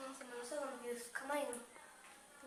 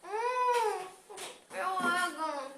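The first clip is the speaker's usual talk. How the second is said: delighted tasting; 4 semitones higher, 5 dB louder, much slower than usual. Real, not acted. Blindfold taste test reaction.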